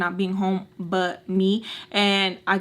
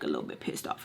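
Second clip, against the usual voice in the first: whisper; 12 dB quieter, faster than usual. Real, not acted. An aside about her own anger.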